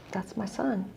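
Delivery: soft, heartfelt murmur; motherly feeling. Retold or imitated. Mother claiming a hurting boy as hers, hushed. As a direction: say it quietly, as a mother would. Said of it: "I felt that as a mom".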